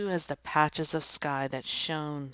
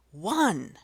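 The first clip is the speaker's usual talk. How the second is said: put-on children yelling the count; yelled count; 4 semitones higher, 6 dB louder than usual. Children counting down the kickoff, yelled. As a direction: shout it out, counting. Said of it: "yelled the children".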